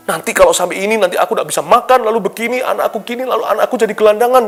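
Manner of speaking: imitating a frightened believer fretting about the future; anxious worrying. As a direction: spiral into mock panic about tomorrow. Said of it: "Nah, itu ketakutan".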